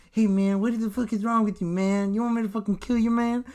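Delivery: high-pitched attempt at the boxer's voice; Mike Tyson. Host tries again as boxer threatening someone, high impersonation. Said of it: "tried so hard to do with that impersonation".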